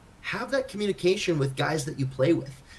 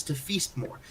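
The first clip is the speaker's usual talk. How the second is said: ordinary delivery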